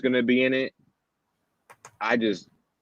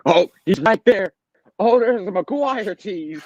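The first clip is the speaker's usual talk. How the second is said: hyped-up gushing, higher; mock excited fan; 9 semitones higher, slower than usual. A fan spotting a Spider-Man tease, gushing. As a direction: gush like a fan spotting a cameo. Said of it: "and it's like".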